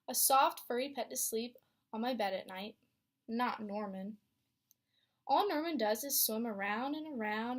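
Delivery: lively read-aloud storytelling voice; story narration. A narrator's reading. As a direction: read it to a class of young kids. Teacher reads picture book aloud.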